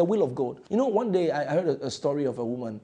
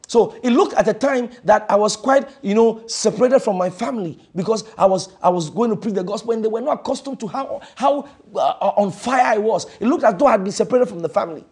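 fiery preaching; 7 semitones higher, 8 dB louder than usual. Acted. Preaching at full force.

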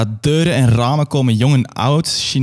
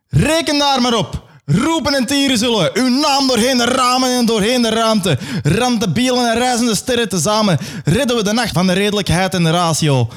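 1 ecstatic crowd; 9 semitones higher than usual. Acted. Crowd answers the leader.

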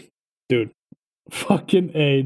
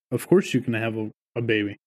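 ordinary talk, nothing marked

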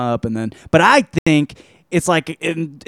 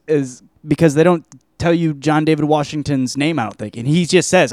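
ordinary delivery

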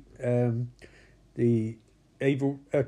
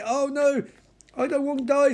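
mock panic; 9 semitones higher than usual. Passenger panicking in crash.

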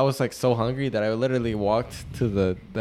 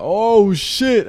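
put-on scared kid; 10 semitones higher, 8 dB louder than usual. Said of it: "And I was like".

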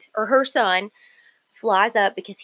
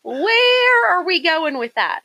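exaggerated hold-on-a-minute alarm; playful protest; 2 semitones higher, 5 dB louder, slower than usual. Real, not acted. Mock objection to co-host's opinion.

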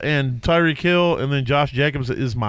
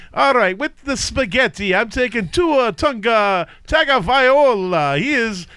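much higher hammed-up mock Italian voice; Italian accent; 9 semitones higher than usual. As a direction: read the picks like a cartoon Italian chef. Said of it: "Three is Italian".

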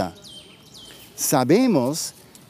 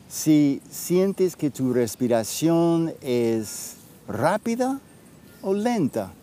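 right in your ear. Acted. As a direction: guide them gently inward, with long pauses. Leads a guided breathing practice.

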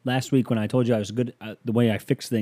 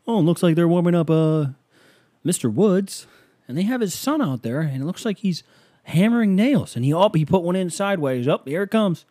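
lightly voiced play-by-play, higher; sports announcer; 7 semitones higher, 5 dB louder than usual. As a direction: call it like a baseball commentator. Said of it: "out into the bullpen".

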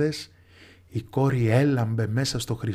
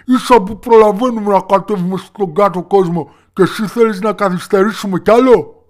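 exasperated, high and loud pleading; desperate father; 7 semitones higher, 8 dB louder than usual. A narrator's reading. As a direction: plead loudly with your daughter, at your wits' end. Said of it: "είχε απελπιστεί ο δύστιχος". (απελπισμένος πατέρας, δυνατά και ψηλά)

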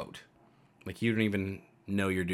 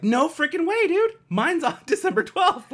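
loud, squeally-high fake excitement; mock amazement; 9 dB louder than usual. Telling someone their birthdays nearly match, loud high mock amazement. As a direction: overdo the thrill, on the edge of laughing.

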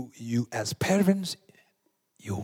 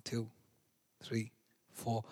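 ordinary talk

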